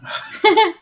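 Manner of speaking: high, loud burst of laughter; sudden amused cackle. Real, not acted. Amused at rival's near miss.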